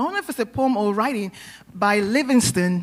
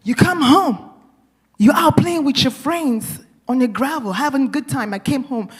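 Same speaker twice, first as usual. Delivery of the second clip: ordinary talk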